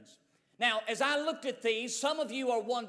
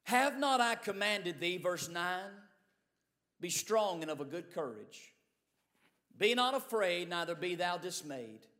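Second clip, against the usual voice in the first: scripture reading; 4 semitones lower than usual. Acted. Preacher reading Joshua aloud to the congregation, solemn scripture reading. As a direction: read the verses like holy words.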